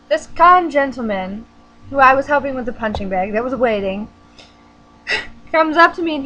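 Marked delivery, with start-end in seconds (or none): none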